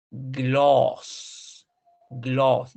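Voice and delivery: "gloss" with a long, drawn-out O; long vowel. Shown as a demonstration. Teacher showing students the word, "gloss" with long O. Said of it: "además es larga".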